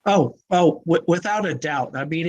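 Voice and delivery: ordinary talk, nothing marked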